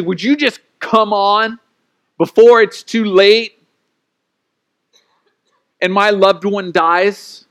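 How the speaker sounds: slow, insistent desperate appeal; urgent plea. Acted. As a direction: beg for help before it's too late. Voices a desperate parent pleading.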